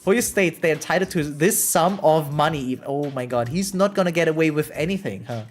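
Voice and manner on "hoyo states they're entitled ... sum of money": normal talking voice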